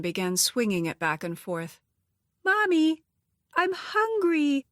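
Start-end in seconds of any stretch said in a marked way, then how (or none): 2.4-4.7 put-on high, whiny small child; a hungry little kid tugging at mom; little girl voice. A narrator's reading. Little girl whining for food.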